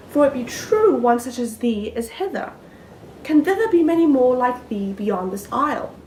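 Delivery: lovestruck maiden, wondering aloud in theatrical verse; earnest stage soliloquy, swelling from musing to ardent love; Miranda's soliloquy. Acted. Performs own Miranda soliloquy, then explains it.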